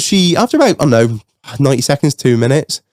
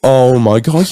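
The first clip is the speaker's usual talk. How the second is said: ordinary delivery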